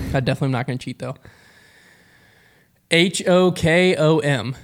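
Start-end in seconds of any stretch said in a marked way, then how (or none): 2.9-4.6 slow letter by letter spelling; each letter spaced and deliberate. Acted.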